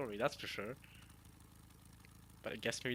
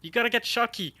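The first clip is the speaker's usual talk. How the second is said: excited; 8 semitones higher, 6 dB louder than usual. Real, not acted. Hyped reaction to manga.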